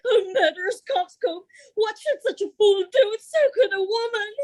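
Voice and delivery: crying scorn. Acted. Emilia berating Othello through tears, crying. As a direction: sob out your contempt.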